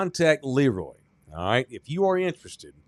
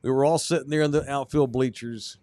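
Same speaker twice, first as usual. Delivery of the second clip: usual speaking voice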